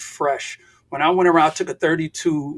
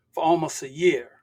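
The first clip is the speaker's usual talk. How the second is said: normal talking voice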